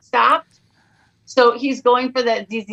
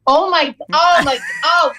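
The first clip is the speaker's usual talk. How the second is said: normal talking voice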